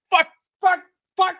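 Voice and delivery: high, loud repeated curses; frustrated swearing. Retold or imitated. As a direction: hammer out the curses in exasperation. Heading back to shed, frustrated.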